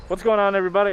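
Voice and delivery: muffled behind a face mask; through a face mask. Real, not acted. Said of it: "hear me with the mask on".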